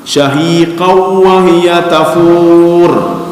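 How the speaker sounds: slow, chanted Arabic verse; Quran recitation. Acted. Preacher quoting the verse to the congregation, slow chanted recitation. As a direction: recite the verse slowly and melodiously.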